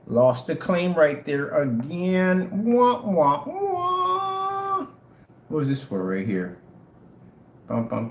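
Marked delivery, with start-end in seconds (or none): 2.6-4.9 high "wah, wah" with a long held final note; taunting loser's wail; drawn-out mocking wah-wah; mock sad trombone. Mocks another lost claim.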